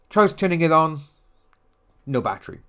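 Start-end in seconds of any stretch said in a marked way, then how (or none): none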